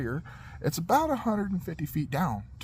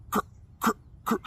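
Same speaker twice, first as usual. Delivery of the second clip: short, separated crunching noises; mouthed "cr" sound effects; imitated creaking; much faster than usual. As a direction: imitate a truck creaking backwards in jerks. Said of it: "roll back and go".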